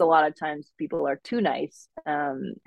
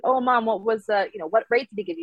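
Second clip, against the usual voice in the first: put-on higher Minnesota accent, as herself asking; 6 semitones higher, faster than usual. Daughter asks what rate the banker gave, Minnesota accent. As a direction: press Mom in a Minnesota accent. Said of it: "dial up that accent".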